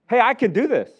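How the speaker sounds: loud, high boastful cry; brash teen bragging at the wheel; cocky child driver. Retold or imitated. Overconfident child learning to drive, loud and boastful. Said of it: "other end of the spectrum".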